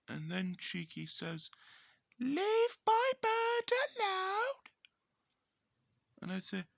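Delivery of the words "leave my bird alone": very high, loud squawking parrot. Retold or imitated. Cheeky the parrot copying its owner, shrill squawk. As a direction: screech it out like a talking parrot, slow.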